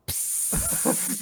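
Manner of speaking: high unvoiced hiss of a sound effect; comic hissed sound effect; piss sound effect. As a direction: make the little wetting noise. Pants-wetting sound effect.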